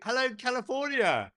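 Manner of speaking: high, loud hail to distant viewers; big, projected greeting; calling out. Real, not acted. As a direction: shout hello across an ocean.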